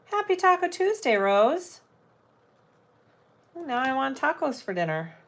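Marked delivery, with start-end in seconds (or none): 0.1-1.2 Happy Taco Tuesday sung out much higher; cheery singsong greeting. Real, not acted. Greeting a livestream viewer on Taco Tuesday, high singsong. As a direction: lift the whole greeting up high and bright, like a little tune.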